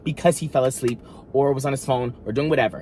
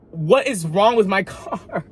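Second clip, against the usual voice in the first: higher, slow, laughing through it; incredulous and half-laughing; exasperated laugh; 6 semitones higher, slower than usual. Retold or imitated. Car keeps breaking down, exasperated laughing question.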